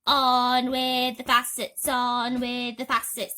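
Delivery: slow, loud booming call; distant shout. Acted. Cloud man calling from above, slow booming shout. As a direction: boom it down from the sky.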